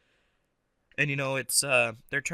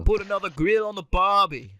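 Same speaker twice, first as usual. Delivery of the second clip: put-on loud, pitched-up Australian accent; hammed-up Aussie, loud and high; 5 semitones higher, 5 dB louder than usual. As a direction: try a broad, bad Australian accent. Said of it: "I could try to do Australian".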